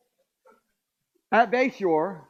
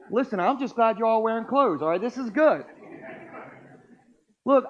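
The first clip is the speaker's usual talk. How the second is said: usual speaking voice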